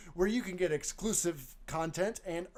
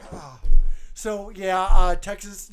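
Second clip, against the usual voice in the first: ordinary talk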